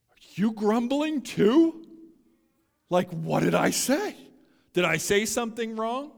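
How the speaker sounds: high, loud, put-on worried questions; rising, pleading pitch, hammed-up hurt; mock insecure questioning. Preacher mimicking a rattled Jesus who hears grumbling, mock insecure. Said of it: "That's not Jesus' attitude here".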